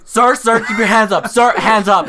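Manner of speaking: security guard shouting. Retold or imitated. Retelling the Disney guard yelling at him, loud. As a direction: bark it like a guard at a checkpoint.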